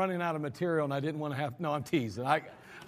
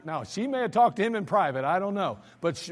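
ordinary delivery

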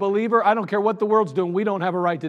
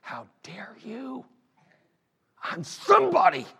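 gritted-teeth anger; 4 semitones higher than usual. Acted. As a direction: whisper through gritted teeth, then burst out. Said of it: "He was gritting his teeth".

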